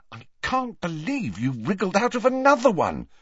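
put-on high-pitched, incredulous girl; exasperated Hermione. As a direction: marvel in exasperation, pitch swooping up.

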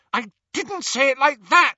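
high, loud, desperate denial; crying out. Acted. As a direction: cry out, pleading with Harry. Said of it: "she cried".

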